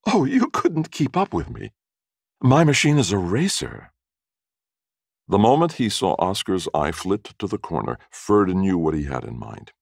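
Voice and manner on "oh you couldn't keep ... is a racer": very high female boast; high-voiced, playful woman; young woman's voice. A narrator's reading. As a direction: pitch it well up, a woman teasing a would-be companion. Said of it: "notes of laughter lifted the young woman's bosom".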